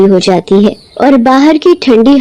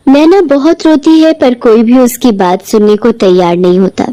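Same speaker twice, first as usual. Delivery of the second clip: ordinary delivery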